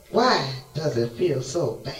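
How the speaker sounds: ordinary talk, nothing marked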